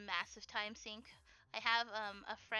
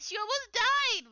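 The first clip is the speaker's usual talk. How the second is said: louder, higher and slow; outraged; 10 semitones higher, 10 dB louder, slower than usual. Real, not acted. Vlogger ranting to viewers about the heroine, loud slow outrage. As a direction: hammer home the outrage.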